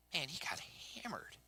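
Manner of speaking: drops to a hushed whisper; whispered aside. Real, not acted. Preacher whispers the punchline to the congregation. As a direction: let it slip out under the breath. Aside about the king's fall.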